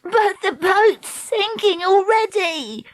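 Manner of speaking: high, drawn-out wail; panicked cry. A narrator's reading. Treacle protesting as the boat fills, panicked cry. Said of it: "Treacle cried".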